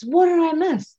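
insistent repeat. Retold or imitated. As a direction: push harder the second time. Midwife eager to hear the news.

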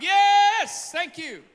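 high, loud yes, thank you; loud praise. Acted. Acting out the healed family's joyful praise, shouting. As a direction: burst out praising God.

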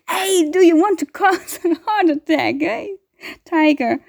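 high, rattled telling-off; startled scolding. Real, not acted. Scolding the cat that scared them, rattled. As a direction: scold the cat, still giddy from fright.

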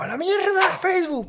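high, furious yell; frustrated outburst. Pretend. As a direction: fling it away in a rage.

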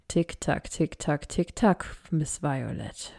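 gleeful, slow taunting tick-tock; relishing. A narrator's reading. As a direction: savour every beat like a villain. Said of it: "machte Ultron nur genüsslich". (genüsslich und langsam verhöhnend)

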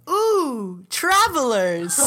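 high, loud squealing gnome greeting; excited gnome. Acted. Gnome greets the travelers on the hill, high and delighted. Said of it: "he says".

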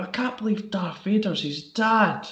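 high, slow hammed-up gloating; gleeful fake surprise, pitched high; mock spoiler shouter. A prankster outside a Star Wars showing, high hammed-up gloating. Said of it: "stood outside ... going".

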